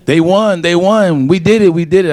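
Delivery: high, excited mock cheering; imitated celebrating fans. Imitating Michigan State fans running down Main Street, elated. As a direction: cry it out like a jubilant fan.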